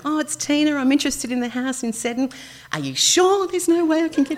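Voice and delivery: high, sugary voice for a phone message; sweet pleading tone. Retold or imitated. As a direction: lay the sweetness on thick, half laughing. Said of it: "Use that tone".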